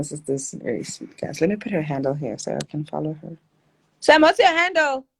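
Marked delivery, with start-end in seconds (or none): 4.0-5.1 loud, high call to her sister. Real, not acted. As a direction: shout the question to the other room.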